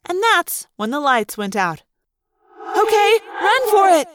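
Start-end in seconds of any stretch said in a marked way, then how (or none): 2.8-4.1 high, loud call to flee; urgent cry. A narrator's reading. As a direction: call out the signal to run. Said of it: "Cassie cried".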